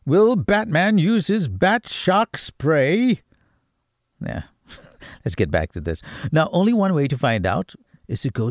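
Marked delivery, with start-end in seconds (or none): 0.1-3.2 higher, louder, slow dramatic cliffhanger narrator; Batman announcer. Pretend. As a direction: boom it out like a cartoon announcer, slowly. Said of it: "I sound like the announcer on Batman now".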